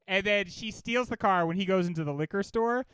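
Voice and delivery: ordinary delivery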